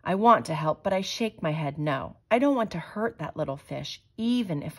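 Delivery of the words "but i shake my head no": plain reading voice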